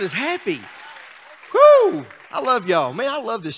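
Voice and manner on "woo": high, loud woo; a real joyful holler; excited whoop. Real, not acted. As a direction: let out a big church whoop. Preacher whoops in celebration.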